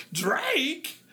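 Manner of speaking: a falling, puzzled "[name]?" with a touch of disbelief; surprised [name]. Retold or imitated. Host reacting to a listener's message, puzzled and surprised. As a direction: let "[name]?" drop as if half asking.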